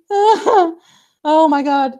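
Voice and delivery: high, loud laughing gasp. Real, not acted. Laughing fit at the stream glitch.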